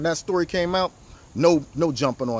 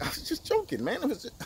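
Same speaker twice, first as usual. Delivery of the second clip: high mock innocent whine, much higher; mocking Schiff; 11 semitones higher, 7 dB quieter than usual. Schiff brushing off the call, high mock innocence. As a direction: brush it off in a high sing-song. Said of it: "he wrote it off like".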